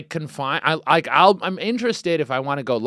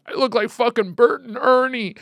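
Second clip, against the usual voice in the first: higher, whiny imitation; mock crying guy; 11 semitones higher, 4 dB louder than usual. Retelling a haircut video, imitating the crying man. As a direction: whimper it like a sad customer. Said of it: "He's like".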